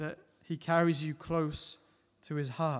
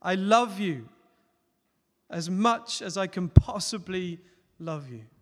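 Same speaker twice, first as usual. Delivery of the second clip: louder, calling out with all he has; loud and clear; 2 semitones higher, 8 dB louder than usual. Retold or imitated. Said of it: "as loud and as clear as he possibly could".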